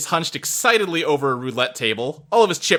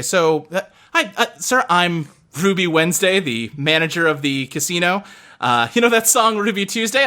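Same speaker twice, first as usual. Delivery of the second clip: higher, put-on customer-service sweetness; forced friendly manager; 4 semitones higher than usual. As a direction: keep the fake smile going. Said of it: "extremely artificial smile".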